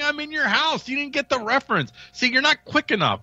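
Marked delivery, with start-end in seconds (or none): 0.0-0.9 high cartoon catchphrase imitation; Master Shake. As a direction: quote the cartoon in a pitched-up voice. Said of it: "it's Master Shake saying".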